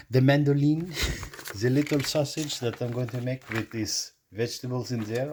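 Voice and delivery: a mock French accent, badly faked; poor French accent. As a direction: ham up a clumsy French accent. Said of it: "my poor attempt at doing French accent".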